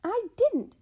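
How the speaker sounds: high, loud denial; older sister denies hitting her; indignant denial. Acted. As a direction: snap back in outrage.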